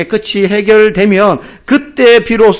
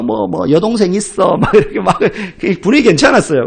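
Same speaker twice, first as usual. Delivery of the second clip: usual speaking voice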